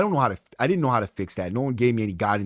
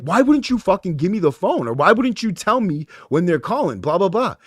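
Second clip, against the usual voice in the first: higher, heated complaint down the phone; fired-up yelling at staff, a bit higher; retold angry rant; 7 semitones higher, 3 dB louder than usual. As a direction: replay the frustration of being kept in the dark. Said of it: "And I'm bugging out".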